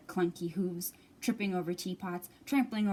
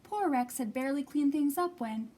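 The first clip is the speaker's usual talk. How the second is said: usual speaking voice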